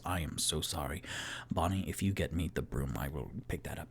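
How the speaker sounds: low, hushed and apologetic; soft apology. Acted. Toot Toot offering Bonnie to sweep up, soft apology. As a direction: say sorry quietly, voice dropped low.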